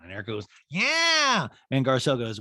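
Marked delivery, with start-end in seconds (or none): 0.7-1.5 put-on high, long drunken yeah; hammed-up sloshed agreement; mock drunk diva. Recapper mimics drunk Erica answering.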